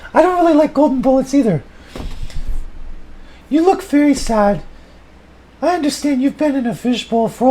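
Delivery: high, loud put-on woman's voice; Sally chiming in on the fish moment, high and loud; Sally's voice. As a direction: answer as Sally in a high falsetto.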